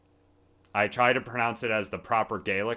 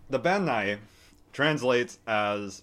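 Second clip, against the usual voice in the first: ordinary delivery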